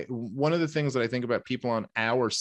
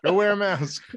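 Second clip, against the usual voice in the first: mock scolding order; 8 semitones higher, 5 dB louder than usual. Joking retort to crazy maskers.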